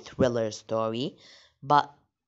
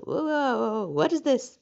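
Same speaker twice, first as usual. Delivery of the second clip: startled whoa; 9 semitones higher than usual. Real, not acted. A sudden ad pops up.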